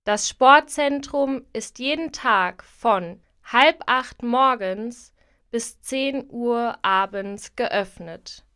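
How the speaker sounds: normal talking voice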